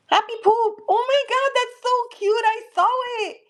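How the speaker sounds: high, delighted gushing; bubbly delight, voice way up; excited squeal. Real, not acted. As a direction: gush over something cute. Delighted reaction to a card.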